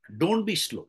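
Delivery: ordinary delivery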